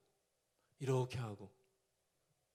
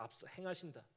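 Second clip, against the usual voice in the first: ordinary talk, nothing marked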